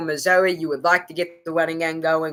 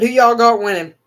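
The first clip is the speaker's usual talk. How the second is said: louder, raised call to the viewers; calling out to chat; 6 semitones higher, 9 dB louder than usual. Real, not acted. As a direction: toss the question out loud to chat.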